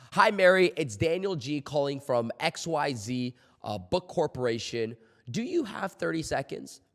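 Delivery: ordinary talk, nothing marked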